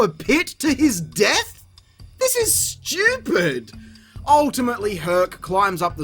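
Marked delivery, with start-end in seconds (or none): none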